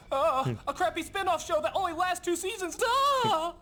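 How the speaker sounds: high-pitched hammed-up cry; comic outburst of fake dismay; mock horror. Mock outbursts at cast's other credits.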